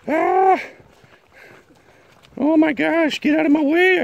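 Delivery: very high, squeaky protest; squealed pet talk. Real, not acted. Vlogger swarmed by puppies underfoot, high squealing voice.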